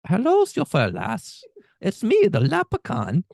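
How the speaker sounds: high, slow cartoon Irish brogue; Irish leprechaun. Co-host's best Irish on request, squeaky-high leprechaun Irish. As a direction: pipe it up loud as a hammed-up Irish leprechaun. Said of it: "a really good leprechaun".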